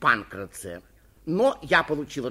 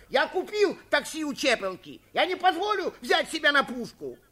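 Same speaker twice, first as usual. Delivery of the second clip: high, agitated yelling; strained high cry of denial; shouting; 11 semitones higher, 4 dB louder than usual. A narrator's reading. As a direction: protest frantically at the top of the voice. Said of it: "кричал Суханок".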